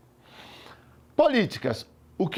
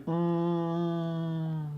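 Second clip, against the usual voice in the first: long, soft monotone "hmm"; pondering hum; 6 dB quieter than usual. Acted. Student faces the question, long pondering hum. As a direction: imitate a student stuck thinking, drawn-out hum.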